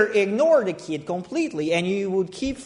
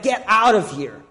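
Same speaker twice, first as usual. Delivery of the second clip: mild mock yell; 3 semitones higher than usual. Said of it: "is screaming at your back".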